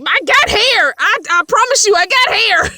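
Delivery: put-on high, loud whiny little girl insisting; pleading kid. Little girl pleading to everyone that her hair is long, high and loud. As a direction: beg like a kid tugging her bangs down. Child pleading while pulling bang.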